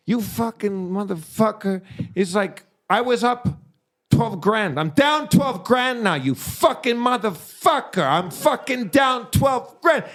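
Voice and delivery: imitating a gambler cursing his buddy after losing big; furious gambler. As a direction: rant like you just blew twelve grand. Gambler raging over lost money.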